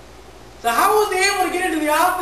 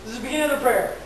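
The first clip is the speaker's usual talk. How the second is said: usual speaking voice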